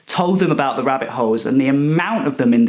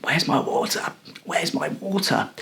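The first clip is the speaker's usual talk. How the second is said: breathless whisper fading out; dry croaking plea for water; hoarse whisper; 6 dB quieter than usual. Retold or imitated. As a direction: whisper it, parched and desperate. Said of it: "I was like".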